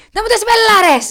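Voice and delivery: shrill-high, full-volume shouting over the stage; outraged heckler yell. Acted. Audience member yelling during a theatre show, outraged shout.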